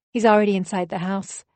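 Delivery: ordinary talk, nothing marked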